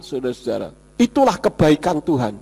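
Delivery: ordinary talk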